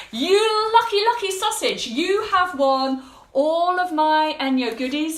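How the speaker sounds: high, loud, thrilled cheer; excited congratulations. Real, not acted. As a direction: gush over the lucky winner. Congratulating the giveaway winner.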